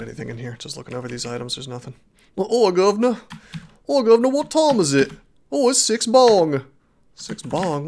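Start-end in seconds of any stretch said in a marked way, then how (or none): 2.3-5.1 high, loud mock-Cockney British; terrible British accent. Streamer playing Londoner asking the time, hammed-up British accent. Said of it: "terrible British accent". 5.5-6.6 high, loud, slow mock-British; hammed-up British answer with drawn-out vowels; terrible British accent. Streamer playing Londoner telling the time, hammed-up British accent. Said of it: "terrible British accent".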